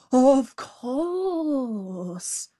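hammed-up frightened jackal; pretended fright. A narrator's reading. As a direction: exclaim in feigned alarm. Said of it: "pretending to tremble with fright".

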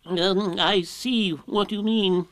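drawn-out, hesitant wise-owl character; wise, thoughtful Owl. A narrator's reading. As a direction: pretend to ponder, stretching every word. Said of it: "looking wise and thoughtful".

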